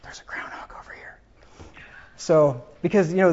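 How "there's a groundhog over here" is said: hushed, secretive whisper; very soft whispered alert; imitated whisper. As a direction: whisper it like a tip-off down the phone. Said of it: "it was a whisper".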